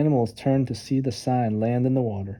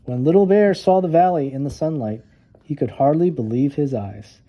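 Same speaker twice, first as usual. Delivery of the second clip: plain reading voice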